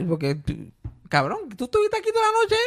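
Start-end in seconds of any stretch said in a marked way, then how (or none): 1.1-2.5 pitched way up in exasperation; high mock outrage. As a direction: throw it back at the customer, incredulous. Mock retort to customer.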